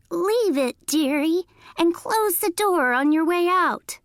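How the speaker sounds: high fake granny voice; high-pitched granny imitation. A narrator's reading. Coyote trying to sound like Granny, high-pitched voice. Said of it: "in a high-pitched voice".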